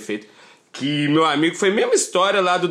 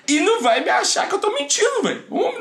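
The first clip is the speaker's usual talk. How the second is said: laughing. Real, not acted. Rindo ao contar o golpe.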